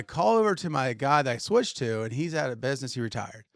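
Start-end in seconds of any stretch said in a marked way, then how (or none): none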